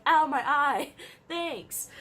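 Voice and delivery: hammed-up ow then laugh; mock pained yelp. Retells getting hit in eye.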